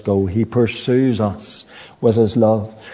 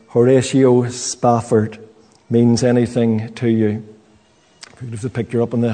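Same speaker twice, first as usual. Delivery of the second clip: ordinary talk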